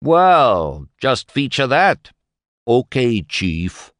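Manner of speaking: derisive. Acted. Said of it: "He was derisive now".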